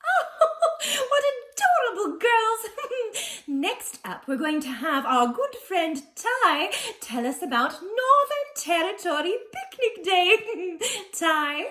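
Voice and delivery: high, giggly presenter voice; gushing hostess. Acted. Presenter introducing her friend Ty after the interview, high and gushing. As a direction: gush sweetly in a high pitch.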